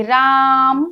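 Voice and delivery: राम called out long, as to someone standing far away; calling out. Shown as a demonstration. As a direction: call राम across a distance, stretching the आ. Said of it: "उसे जोर से आवाज लगानी पड़ेगी". (दूर खड़े व्यक्ति को पुकारते हुए राम)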